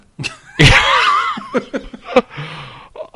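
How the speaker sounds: long, high, loud burst of laughing; real high-pitched cackling laugh; real laughter. Real, not acted. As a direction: crack up helplessly at your own story. Listener cracks up about broken finger.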